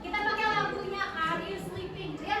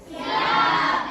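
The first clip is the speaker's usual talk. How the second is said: loud class answer; 10 dB louder than usual. Real, not acted. Class shouts ready.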